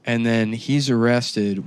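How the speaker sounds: ordinary delivery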